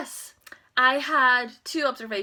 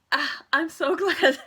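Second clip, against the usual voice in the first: laughing relief; 7 semitones higher, 5 dB louder than usual. Real, not acted. Relief that it's over.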